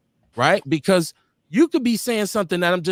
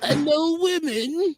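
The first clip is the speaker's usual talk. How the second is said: slow, high Cosby impression; Bill Cosby voice; 10 semitones higher, slower than usual. Shown as a demonstration. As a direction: drag it out like Cosby. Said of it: "let's go in my Bill Cosby voice".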